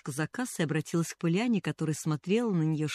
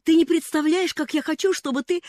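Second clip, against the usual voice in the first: put-on high, loud urgent girl; girl pleading with the cashier to come; eager pleading; 10 semitones higher, 7 dB louder than usual. A narrator's reading. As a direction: keep begging eagerly.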